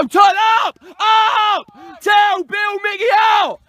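high, urgent touchline calls; real coach's yell; shouted instructions. Real, not acted. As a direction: bark orders out to the team.

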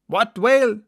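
puzzled shout back; mate calling back. Acted. Guernsey mate calls back across the water, puzzled.

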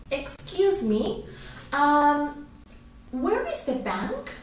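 softly hammed-up lost tourist, slow and rising. Shown as a demonstration. Teacher playfully acts a lost tourist, hammed-up. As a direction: overact asking a stranger for help. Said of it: "Ya, estoy fingiendo mucho".

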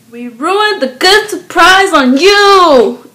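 high, loud and stretched out; hammed-up excited shout. Friends announcing the ruined surprise, loud mock excitement. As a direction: play a gleeful announcement, drawing out the words.

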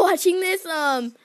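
ordinary delivery

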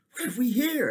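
sharp rising what have we here; startled king calls out; king startled at the river sight; surprised exclaim. Acted.

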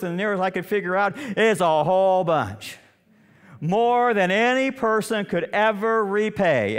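high, loud preaching push; full-force preaching. Real, not acted. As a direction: drive the story home from the pulpit.

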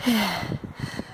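soft low exhausted sigh; tired sigh. Real, not acted. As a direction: sigh out the effort. Exhausted on stairs.

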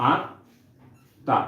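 আত্মা said slowly as "aatta", the ত doubled and held; slow demo pronunciation. Shown as a demonstration. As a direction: stretch out আত্মা with a doubled ত, "aat-ta". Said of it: "আমরা আত্মার উচ্চারণ করি এইভাবে".

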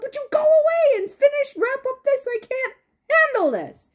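exasperated viewer begging the show; exasperated plea. Retold or imitated. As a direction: beg them to wrap it up, half-joking. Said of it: "and I'm like".

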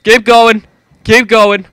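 high, loud urgent push; frantic urging. Real, not acted. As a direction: shout yourself onward. Urging in panic.

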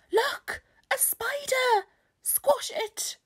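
very high, squealing panic; loud, high-pitched horrified cry; screamed alarm. A narrator's reading. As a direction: shriek at a creepy-crawly. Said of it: "screamed someone".

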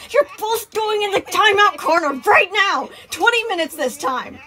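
high, loud caretaker's order; stern scolding. Acted. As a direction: send two kids to timeout, fed up. Sun orders children to timeout.

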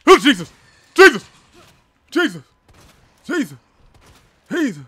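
startled yells at a sudden monster; terrified screaming. Real, not acted. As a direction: scream the name over and over in real panic. Jump scare in horror game.

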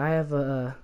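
ordinary talk

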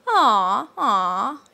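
"Aw" scooped down and swept up; sweet rising tone. Shown as a demonstration. Teacher shows the way the tone goes, rising "Aw". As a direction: swing "Aw" upward like surprise. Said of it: "the way it goes".

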